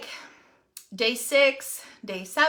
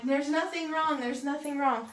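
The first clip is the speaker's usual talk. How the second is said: soft, higher-pitched mock reassurance; insistent denial; 4 semitones higher, 6 dB quieter than usual. Wife retells reassuring her husband, lightly insistent.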